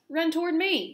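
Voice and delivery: high, loud, excited yell; urgent high-pitched call; gander's yell. A narrator's reading. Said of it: "yelled the gander".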